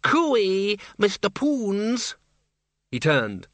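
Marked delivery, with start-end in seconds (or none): 0.0-2.2 high, drawn-out calling out; a cheery high call of Cooey; vampire's cry. A narrator's reading. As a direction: hail him across the crowd in a high sing-song. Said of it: "the cry of the vampires".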